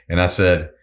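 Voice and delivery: ordinary talk, nothing marked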